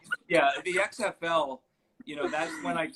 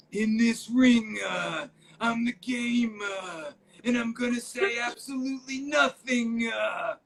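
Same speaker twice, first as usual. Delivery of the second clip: slow, higher mocking Triple H voice; Triple H impression; 9 semitones higher, 3 dB louder, slower than usual. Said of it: "Do the voice".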